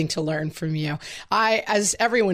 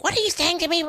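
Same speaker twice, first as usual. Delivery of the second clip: much higher, indignant challenge; confrontational mom; 11 semitones higher, faster than usual. Retold or imitated. Mom confronting her talking son, indignant. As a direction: call him out, affronted. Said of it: "And I was like".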